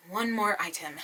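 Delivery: ordinary delivery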